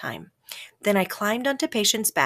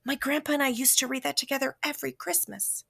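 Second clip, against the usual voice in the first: softly remembering; 5 semitones higher, 5 dB quieter than usual. A narrator's reading. Whispered memory continued.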